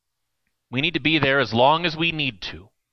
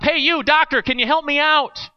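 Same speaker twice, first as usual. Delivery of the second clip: higher, louder call out of a hole; shouting up; 12 semitones higher, 3 dB louder than usual. Acted. Man in a hole calls up to a passing doctor, shouting. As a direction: shout up from the bottom of the pit. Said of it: "the guy shouts up".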